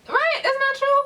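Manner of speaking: very high, squeaky mock disbelief; incredulous. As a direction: shoot way up in surprised glee. Mock disbelief at mom's news.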